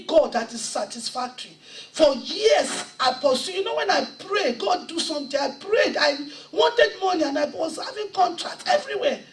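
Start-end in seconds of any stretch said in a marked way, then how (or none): 1.9-9.2 raised, louder preaching voice, laughing in places; high, loud revival sermon delivery; full-force preaching. Acted. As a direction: preach your testimony with rising fire. Impassioned testimony, preaching hard.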